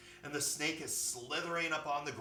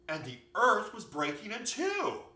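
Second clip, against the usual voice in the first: loud, high, dramatic climax; worked-up little bunny; alarmed bunny; 8 semitones higher, 6 dB louder than usual. A narrator's reading. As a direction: exclaim it as terrible news. Bunny retells the disaster.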